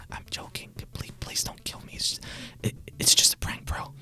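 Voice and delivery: whispered mock pleading; joking whispered plea. Vlogger begging viewers' pardon for an Irish joke, whispered. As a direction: whisper a hasty comic apology.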